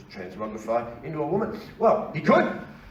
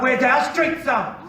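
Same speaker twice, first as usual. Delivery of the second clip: mock whiny protester; 13 semitones higher than usual. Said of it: "are they gonna arc up".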